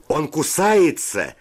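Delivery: high, drawn-out yell of alarm; high-pitched outcry from the brawl; cry out. A narrator's reading. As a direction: call it out, pitch leaping high. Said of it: "крикнул кто-то".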